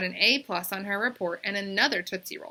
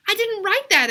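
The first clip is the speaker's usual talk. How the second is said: put-on much higher and louder, a girl protesting; exclaiming; 14 semitones higher, 12 dB louder than usual. A narrator's reading. Bebe protesting to her teacher, a high loud exclamation. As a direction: blurt out the denial. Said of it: "exclaimed Bebe".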